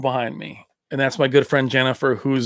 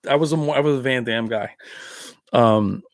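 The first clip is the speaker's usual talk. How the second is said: ordinary delivery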